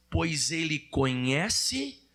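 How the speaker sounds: very slow, phrase by phrase for the congregation; deliberate recitation, pausing so others can join; low, measured call to recite together; leading in unison. Shown as a demonstration. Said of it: "fale junto comigo essa frase".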